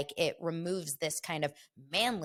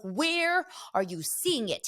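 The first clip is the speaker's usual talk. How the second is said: urgent; 5 semitones higher, slower than usual. Retold or imitated. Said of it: "I'm like".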